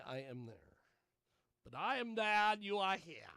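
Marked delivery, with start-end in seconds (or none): 1.6-3.3 higher, louder put-on British accent; mock British accent. Shown as a demonstration. Host showing off his British accent, raised and loud. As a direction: declaim it in a hammy British accent. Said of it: "That's my British, uh, accent".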